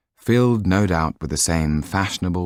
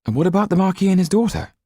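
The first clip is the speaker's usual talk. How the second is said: eager; 4 dB louder, faster than usual. A narrator's reading. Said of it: "asked Yan".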